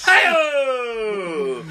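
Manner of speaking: high, loud, drawn-out shout; celebratory whoop. Real, not acted. Comic capping the Polish joke, celebratory whoop. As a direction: holler it like a punchline rimshot.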